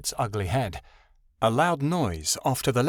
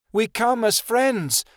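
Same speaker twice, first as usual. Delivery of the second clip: high, slow plea; pitched-up appeal while cowering; cowering cry; 10 semitones higher, 3 dB louder, slower than usual. A narrator's reading. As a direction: cry out slowly and fearfully. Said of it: "Midnight Star cried, cowering".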